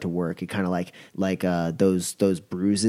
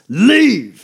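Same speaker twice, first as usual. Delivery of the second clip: "Leave!" bellowed much higher and louder; thundering shout; 12 semitones higher, 10 dB louder than usual. Acted. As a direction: roar it so the ground shakes. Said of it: "the ground kinda rumbles when he says it".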